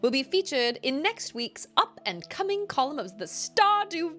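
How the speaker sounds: letter read in a high, put-on British accent; hammed-up British accent on the letter. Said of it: "I can't talk in a British accent anymore".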